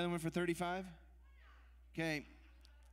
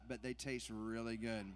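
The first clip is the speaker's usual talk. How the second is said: normal talking voice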